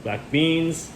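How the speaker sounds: ordinary talk, nothing marked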